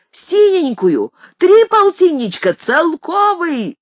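high, loud, stretched bidding shouts; гремящие голоса. A narrator's reading. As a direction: shout rival bids, pitch way up. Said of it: "загремели вокруг меня голоса". (громкие высокие выкрики наперебой)

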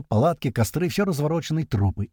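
ordinary delivery

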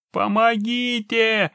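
high, loud, long-drawn cry; yelling for help. A narrator's reading. Trapped man keeps calling for help, panicked yell. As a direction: stretch the cry out at full panic. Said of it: "не прекращал орать человек".

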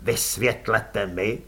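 low, slow angry growl. A narrator's reading. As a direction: growl it at her through restrained fury. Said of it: "zavrčel".

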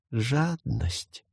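a soft ghostly sigh. A narrator's reading. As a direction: breathe the word out sadly. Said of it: "вздохнул Ри". (со вздохом)

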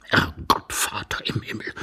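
breathed, despairing appeal to heaven; hushed exclamation of misery; whispered lament. Acted. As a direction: sigh it out almost voiceless. Ivan laments his humiliating position.

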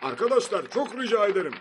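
high, loud appeal; pleading. A narrator's reading. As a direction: beg the crowd over the noise. Pleading over unstoppable applause.

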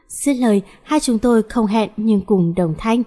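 usual speaking voice

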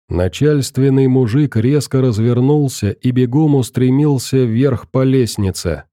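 ordinary delivery, nothing marked

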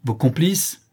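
indignant. A narrator's reading. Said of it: "dit la mère indignée".

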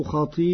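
"خطيئاته" with a long a after the hamza; added alif. Shown as a demonstration. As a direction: stretch the a in "خطيئاته". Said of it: "بزيادة ألف بعد الهمز".

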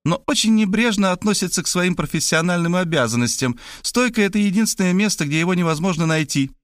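put-on high-pitched girl, chatty and insistent; brash young woman. A narrator's reading. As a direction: rattle on in a high girlish voice, then demand. (высокий девичий голос, бойко и напористо)

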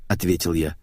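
plain reading voice

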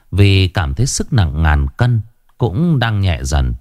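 ordinary delivery, nothing marked

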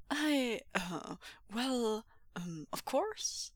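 soft, slow, faltering agreement; flustered hesitation. A narrator's reading. Uncle put on the spot by visitor, flustered. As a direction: stall through the ums before agreeing.

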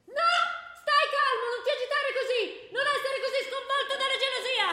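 high, loud, hammed-up shouting; frantic shout. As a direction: shriek it over the top to calm him. [name] acts out the scene.

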